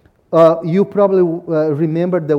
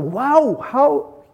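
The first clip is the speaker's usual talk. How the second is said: higher, delighted friend's exclamation; bright retold wow, higher pitched; impressed; 6 semitones higher, 4 dB louder, slower than usual. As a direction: imitate a friend marvelling at a grown boy. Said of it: "Abdueli saw my son and said".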